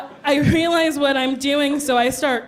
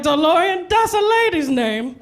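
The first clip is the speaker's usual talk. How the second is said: put-on higher-pitched drunken revelation; drunken excitement; 4 semitones higher than usual. Drunk passenger blurting at the movie, drunken excitement. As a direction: blurt it out in tipsy delight, raised pitch. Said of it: "I was so drunk".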